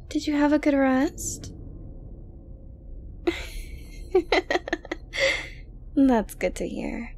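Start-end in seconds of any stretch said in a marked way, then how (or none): 1.6-5.9 airy giggle rising up high; a light, delighted little giggle; soft breathy giggle. Acted. Girlfriend giggling sweetly at her captive boyfriend.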